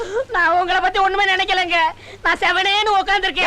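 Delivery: high-pitched, pleading yelp; panicked protest. Acted. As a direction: plead innocence while being hit. Beaten man protests his innocence.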